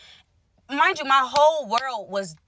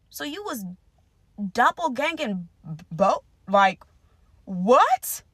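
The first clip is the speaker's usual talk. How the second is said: incredulous confrontation; 2 semitones lower, slower than usual. Retold or imitated. Confronting her boyfriend, incredulous and drawn out. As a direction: slowly spell out the disbelief. Confronting him about the cheating.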